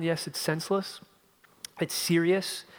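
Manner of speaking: ordinary talk, nothing marked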